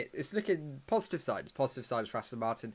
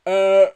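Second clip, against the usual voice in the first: a loud, open-mouthed yawn; real yawn mid-commentary; big yawn; 7 semitones higher, 17 dB louder than usual. Real, not acted. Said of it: "I'm yawning".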